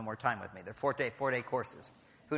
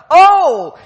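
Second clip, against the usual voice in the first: much higher, much louder startled exclamation; 23 dB louder than usual. Retold or imitated. The men startled by the wife's plea, loud and high. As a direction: jolt into action with a shout.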